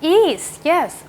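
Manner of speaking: ordinary talk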